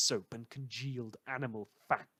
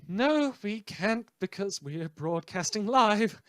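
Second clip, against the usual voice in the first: louder, slower, snapping back at himself; exasperated retort; 6 semitones higher, 6 dB louder than usual. Acted. Radio host retorts to his own plea, exasperated and louder. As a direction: bite back the answer with mock outrage.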